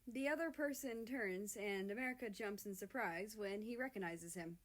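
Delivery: ordinary delivery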